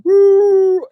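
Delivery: high, loud mock animal howl; imitated howl. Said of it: "starts going".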